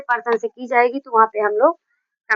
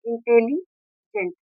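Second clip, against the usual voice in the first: Intelligent slowly split, pause before the last syllable; cut into syllables; 4 dB quieter, much slower than usual. Shown as a demonstration. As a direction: break intelligent apart slowly as इंटेलि, gent. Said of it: "चार बार में ये cut हो रहा है".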